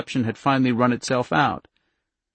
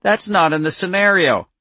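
put-on higher, louder childish protest; petulant, hateful child voice, pitched up; 7 semitones higher, 6 dB louder than usual. A narrator's reading. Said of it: "in a hateful, childish voice".

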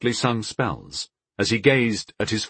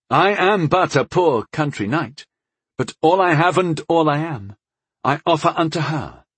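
bold suitor's declaration; 7 semitones higher than usual. Acted. Knight declares his suit to all.

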